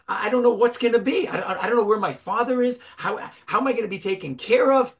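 put-on a frightened boy blurting out his fears quickly; agitated child. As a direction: rush it out like a panicking child. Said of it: "the son is getting more and more agitated".

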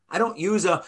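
normal talking voice

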